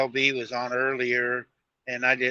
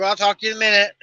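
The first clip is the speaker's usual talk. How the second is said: retold, raised and higher, hurrying off the phone; hurried raised voice; 7 semitones higher, 7 dB louder, much faster than usual. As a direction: tell her fast and loud you're being pulled over. Cutting wife's call short.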